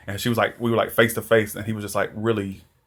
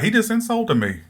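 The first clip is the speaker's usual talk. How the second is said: very high-pitched imitated affront; mock offended; 11 semitones higher than usual. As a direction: squeal it like someone freshly insulted. Retold reaction to an insult.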